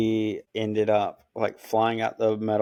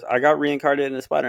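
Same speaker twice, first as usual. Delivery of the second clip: "I Got Reincarnated into a Spider" gabbled fast, higher; rushed title; 5 semitones higher, 5 dB louder, much faster than usual. Retold or imitated. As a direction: rattle the title off quickly. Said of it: "One more time".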